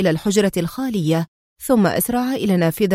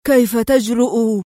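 slow, indignant exclamation; angry outburst; 2 semitones higher than usual. A narrator's reading. Boss outraged at his man's nerve, angry. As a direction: draw it out in affronted anger. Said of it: "ثم هتف في غضب".

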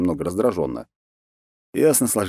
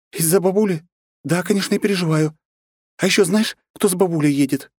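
put-on much higher, agitated girl's voice; nervous young woman; 12 semitones higher, 3 dB louder than usual. Anna frets to Alexander about the guests, high agitated voice. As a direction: voice Anna fretting and flustered. Anna's voice.

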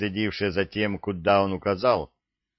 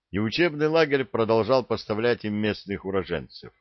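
ordinary delivery, nothing marked

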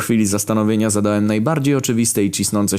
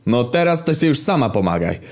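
raised-pitch huffy cry; mild indignant shout; 6 semitones higher than usual. A narrator's reading. Kamil sulking at the old woman, mildly shouted. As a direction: call it out in a huff. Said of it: "a potem krzyknął".

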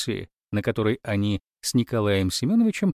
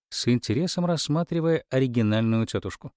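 ordinary delivery, nothing marked

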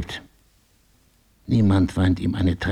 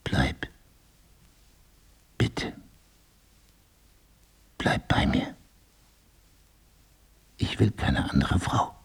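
soft, breathy pleading whisper, much softer; 5 dB quieter than usual. Acted. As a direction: beg her to stay in a hushed whisper. Pleading with Lisa to stay.

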